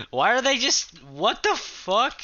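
high, loud startled shout slowing down at the end; shocked yell. Real, not acted. Gamer yelling at mobs, startled loud shout. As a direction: yelp it out in disbelief.

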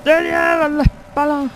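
loud exasperated yell; yelling at the character; panicked curse. Real, not acted.

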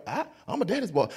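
normal talking voice

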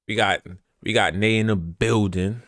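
ordinary talk, nothing marked

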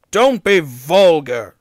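huffy, dignified rebuke. A narrator's reading. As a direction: take lofty offence. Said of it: "said Gordon huffily".

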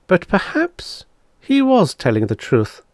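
high, hesitant musing with little force; doubtful murmur. A narrator's reading. Woman alone reconsiders the Devi's claim, doubtfully. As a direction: wonder aloud, unconvinced. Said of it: "she murmured doubtfully".

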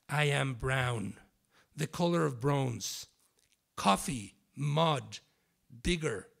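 dramatic literary reading with long pauses. Acted. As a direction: perform it like a spoken-word piece for an audience. Author reads literary text aloud.

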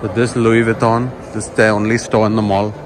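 normal talking voice

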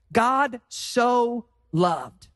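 each word of "God so loved" drawn out, "God" stressed; slow emphasis. Acted. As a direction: lean on "God" slowly. Repeats verse slowly.

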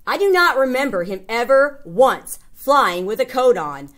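plain reading voice

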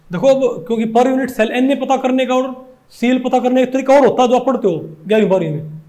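ordinary talk

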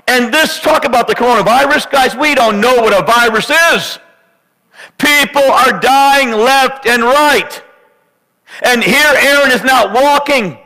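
high, impassioned pulpit preaching; full-force preaching. Acted. Pastor urging congregation about Aaron, fervent high preaching. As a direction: preach it hard and high to the church.

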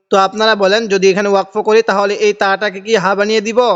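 ordinary talk, nothing marked

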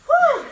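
high, loud puff of breath after effort; tired whew. Real, not acted. Exhaling mid-workout.

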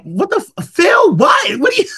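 high, loud mock shout of confusion; startled outburst. Narrator retelling her reaction to the worker, high startled yell. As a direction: blurt it out like you can't believe it. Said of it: "I'm like".

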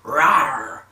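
loud mock monster growl; playful growl. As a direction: growl like an angry little beast. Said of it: "you're like".

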